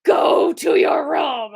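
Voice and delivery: slow, loud barked order; mock shout. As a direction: shout it like a strict parent. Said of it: "She's just like".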